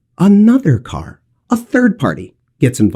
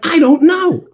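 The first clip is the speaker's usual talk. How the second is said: excited, unsure; 12 semitones higher than usual. Retold or imitated. His tense answer to kids.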